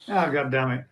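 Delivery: ordinary talk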